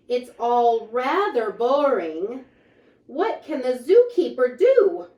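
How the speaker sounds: lively, sing-song picture book reading; reading to children. A narrator's reading. Reading a rhyming book aloud to children, lively. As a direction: read the rhymes out brightly to the kids.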